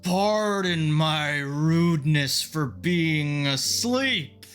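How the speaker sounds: very slow, loud ancient tree voice; slow booming voice of an ancient tree; talking tree. Acted. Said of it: "and the tree speaks".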